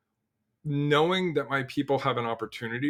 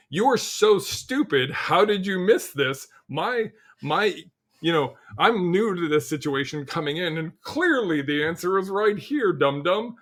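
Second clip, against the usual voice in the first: mock condescension; 6 semitones higher than usual. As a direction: talk down to them like a smug newcomer. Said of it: "It's like".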